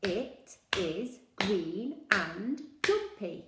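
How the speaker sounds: slow, word by word; slow, even repetition; slow rehearsal. Shown as a demonstration. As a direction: rehearse the sentence slowly. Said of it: "I said it a few times".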